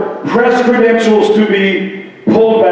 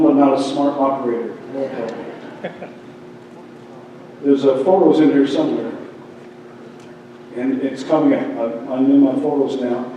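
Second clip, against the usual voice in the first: much softer, lower aside while searching the phone; muttering to himself; 6 semitones lower, 9 dB quieter than usual. Real, not acted. Pastor struggling with his phone on stage, muttering softly. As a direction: mumble under your breath, off-mic. Fumbling with phone mid-speech.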